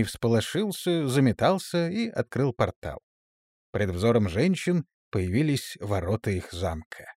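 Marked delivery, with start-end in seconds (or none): none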